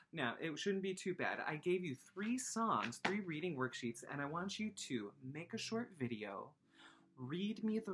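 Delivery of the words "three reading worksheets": usual speaking voice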